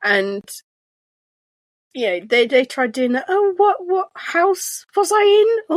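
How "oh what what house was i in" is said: high mock friend voice. Friends asking her house.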